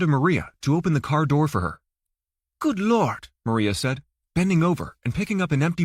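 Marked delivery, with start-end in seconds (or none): 2.6-3.3 higher startled exclamation; shocked at the mess; surprised wife. A narrator's reading. Wife's surprise at vandalism.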